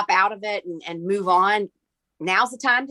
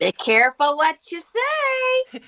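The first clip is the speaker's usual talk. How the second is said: mock warning; 10 semitones higher, slower than usual. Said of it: "And I was like".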